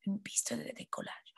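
voiceless whisper of the phrase. Shown as a demonstration. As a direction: whisper it for them to repeat. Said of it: "Répétez". (chuchoté)

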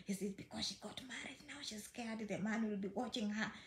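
hushed, breathy gossip among friends; mock gossip, whispered very softly; imagined gossip whisper. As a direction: whisper it like nosy people talking behind her back. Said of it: "I'm like".

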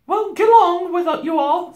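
high, loud shooing; Missus Beaver. A narrator's reading. Beaver wife shooing the others, high and loud. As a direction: dismiss them in a high housewife voice.

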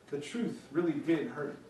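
usual speaking voice

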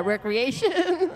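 slow, high, laughing through the word. Real, not acted. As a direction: giggle out the fixed word. Laughs after fumbling the word.

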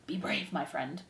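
very soft, confidential encouragement; whispered. A narrator's reading. Threadmaster encouraging the condemned mouse, whispering. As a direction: say it softly, close to the ear. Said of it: "whispered the threadmaster".

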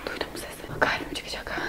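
frightened unvoiced whisper; scared whisper. Real, not acted. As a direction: breathe it out in fear. Hears a noise, scared. (korku içinde fısıltı)

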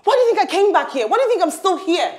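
high-pitched, indignant questions; heated challenge. Acted. Woman justifying her return to her lover, heated challenge. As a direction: demand an answer, pitch right up.

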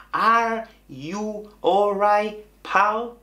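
slow, high, word-by-word English; slowed-down decoding. Shown as a demonstration. Teacher decodes what the coworker was saying, slowly. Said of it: "después entendí que me estaba diciendo".

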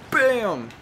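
loud, high punchy exclamation; exclaimed hit. Real, not acted. Player landing a hit in the game, triumphant exclamation. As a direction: punch it out as the blow lands.

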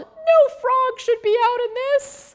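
high, loud reporter voice; yelling Polly. A narrator's reading. Polly soaked in the hurricane, high loud shout. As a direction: shout over the storm. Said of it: "she yelled".

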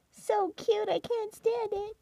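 high cooing over a cute bunny; baby talk to pets. Acted. Owner gushing at her bunny in high baby talk. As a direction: gush up high at the pet.

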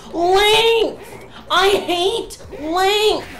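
fed-up yell at long runs; angry outburst. Real, not acted. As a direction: spit out length with disgust. Raging at long game runs.

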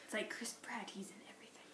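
whisper. Real, not acted. Whispered aside about actor.

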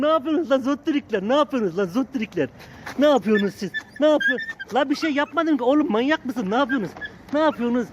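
high, loud playful telling-off; mock scolding puppies. Real, not acted. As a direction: scold the pups loudly but fondly.

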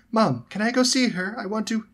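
high, shrill pleading; excited little girl. A narrator's reading. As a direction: beg your mum in an excited squeal. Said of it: "tugging at her mom's sleeve in her excitement".

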